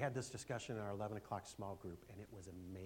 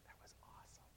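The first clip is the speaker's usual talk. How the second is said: soft whisper under the breath; whispered, awed aside; 19 dB quieter, faster than usual. Real, not acted. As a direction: hush it to yourself. Hushed aside after testimony.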